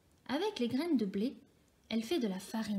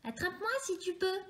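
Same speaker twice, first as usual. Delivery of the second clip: teasing taunt; 8 semitones higher, 3 dB quieter than usual. A narrator's reading. Galette taunts and runs.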